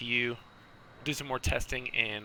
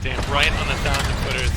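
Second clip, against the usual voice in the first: excited; 10 dB louder than usual. Real, not acted. Lands right on the markers.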